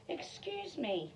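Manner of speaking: a small polite interruption; polite cricket. Acted. Cricket politely addressing Gerald, mild. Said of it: "coughed a cricket".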